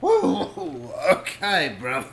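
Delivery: loud, laughing mock Australian accent. Stoned podcast host hamming it up as an Aussie, in a mock Australian accent. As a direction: put on a jokey Aussie bloke voice. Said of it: "he started speaking in an Australian accent".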